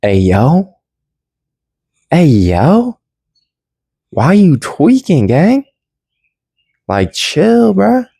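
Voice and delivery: hammed-up mellow savior telling you to relax; Jesus looking down. Jesus tells the worried speaker to chill, high and laid-back. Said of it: "I'm sure Jesus is looking down like".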